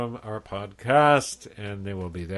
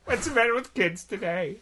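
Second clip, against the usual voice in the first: Paul Lynde; 10 semitones higher than usual. Acted. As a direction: mimic a comedian, pitched way up. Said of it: "I inadvertently was doing Paul Lynde".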